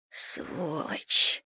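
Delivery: злой шёпот сквозь зубы; прошипи ругательство шёпотом. A narrator's reading. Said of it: "прошептала Анна". (Анна шепчет ругательство вслед Косте, шёпотом)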